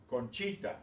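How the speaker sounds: "Conchita" with the middle syllable "chi" stressed; stress on next-to-last syllable. Shown as a demonstration. Teacher reads the word list to show stress, "Conchita" stressed on "chi". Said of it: "they're telling you where the stress is".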